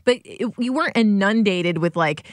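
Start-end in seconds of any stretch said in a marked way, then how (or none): none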